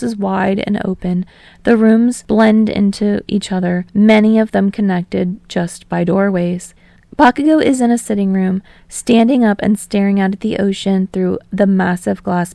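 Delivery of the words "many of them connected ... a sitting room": plain reading voice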